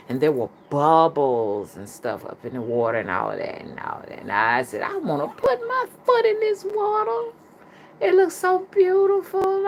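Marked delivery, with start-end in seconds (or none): 4.8-9.7 very high, put-on voice for retold words; squeaky-high mock naive voice; high-pitched self-quote. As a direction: act out your own wish in falsetto. Retells own wide-eyed wish at river.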